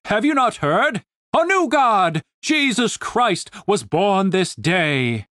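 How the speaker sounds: officer announcing holy news, slowing at the end; pompous commander. Acted. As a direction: proclaim it with religious fervour.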